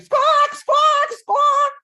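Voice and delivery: loud high squawking like a hen; chicken squawk. Retold or imitated.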